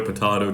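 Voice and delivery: "potato" as po-TAH-to. Shown as a demonstration. Reciting the rhyme's second form, "potato" as po-TAH-to. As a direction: say "potato" with an open ah. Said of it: "the typical".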